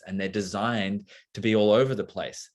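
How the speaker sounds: normal talking voice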